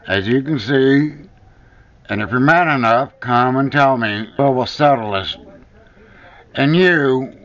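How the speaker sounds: ordinary talk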